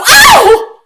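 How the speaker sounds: high loud yelp of pain; sudden pained yelp. Acted. Girl kicked in the shin, sharp loud yelp. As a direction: yelp as the kick lands.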